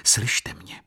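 breathy secret whisper. A narrator's reading. Lady whispers a command to Jan Maria. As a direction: whisper it so only he hears. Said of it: "zašeptala po rusku".

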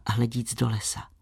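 ordinary delivery

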